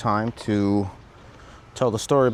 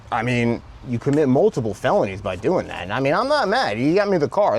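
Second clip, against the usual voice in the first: ordinary talk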